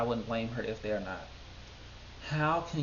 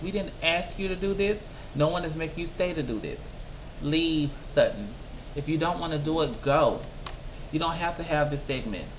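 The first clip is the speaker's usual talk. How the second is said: fed-up scolding, pitch raised; 4 semitones higher than usual. Real, not acted. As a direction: order her out, fed up. Scolding Sutton at the screen.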